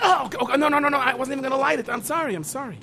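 high, loud flustered backpedal; scrambling to apologize, voice jumping up; panicked apology. Acted. Panicked apology over cigar.

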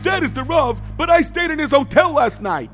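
high, loud exasperated shout; argue, losing patience. Acted. Insistent argument.

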